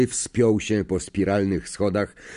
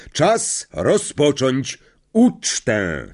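slow, raised ceremonial announcement; Dumbledore; 5 semitones higher, 3 dB louder, much slower than usual. Acted. Headmaster opens the feast, slow higher proclaiming voice. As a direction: proclaim it, stretching each word.

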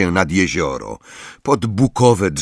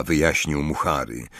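ordinary talk, nothing marked

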